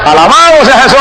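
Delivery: high, ringing cry of praise; exultant praise. Real, not acted. Preacher celebrating seven messages received, exultant shouted praise. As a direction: throw the praise out to the whole church.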